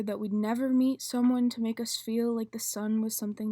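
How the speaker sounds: loud, intense slam poem delivery; spoken word poetry. Acted. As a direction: perform it like a slam poet on stage.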